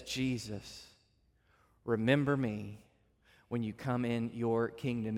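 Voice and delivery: a dying thief asking with nothing to offer; humble plea. Retold or imitated. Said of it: "He just says".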